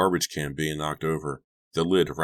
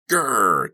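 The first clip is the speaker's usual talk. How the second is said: high, loud playful growl; child's monster roar; 6 semitones higher, 7 dB louder than usual. A narrator's reading. Masked Donnie charges at Chuck, loud playful roar. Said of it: "Donnie roared".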